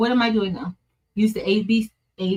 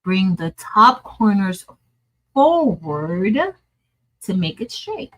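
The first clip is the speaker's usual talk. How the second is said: reading each word out slowly, spaced apart; slowly rereading "Bring the top corners" line by line; slow careful reading; slower than usual. Shown as a demonstration. Rereading the tutorial step slowly to get it right. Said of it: "let me read that slowly".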